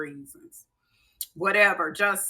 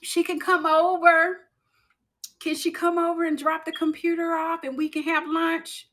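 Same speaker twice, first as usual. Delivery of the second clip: higher-pitched mock-sweet coworker; mimicked request; 5 semitones higher than usual. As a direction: imitate someone angling for a visit.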